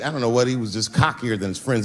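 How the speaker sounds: ordinary talk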